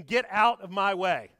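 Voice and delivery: loud, drawn-out shove-past yell; mock scream. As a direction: bark it while barging out. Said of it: "as he's screaming".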